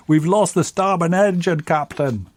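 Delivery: high, loud Scottish ship's engineer reporting trouble; Scottish accent. Acted. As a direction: call it out in a put-on Scottish accent. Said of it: "in that Scottish accent".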